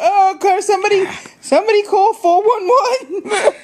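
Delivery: high, hammed-up call for help; mock panic. Host wanting someone to phone for help, mock panic. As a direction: plead for rescue in playful panic.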